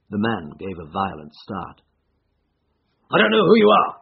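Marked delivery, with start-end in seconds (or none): 3.1-4.0 loud, high-pitched outcry; agitated cry. A narrator's reading. Startled man confronts an unknown visitor, crying out. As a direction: cry out loudly at a stranger. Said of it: "he cried".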